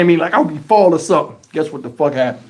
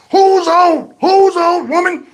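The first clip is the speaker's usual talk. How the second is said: put-on old man retort; 9 semitones higher, slower than usual. Granddad retorting, laughing.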